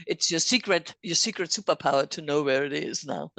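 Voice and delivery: usual speaking voice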